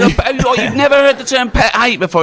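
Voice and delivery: high, laughing disbelief; can't believe he's never heard it; mocking disbelief at a friend's ignorance; incredulous. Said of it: "I said".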